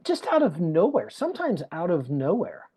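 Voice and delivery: usual speaking voice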